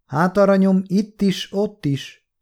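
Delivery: put-on high-pitched, sighing old voice; mildly weary, sighed vagueness; big sigh. A narrator's reading. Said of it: "kezdte az öreg nagyot sóhajtva".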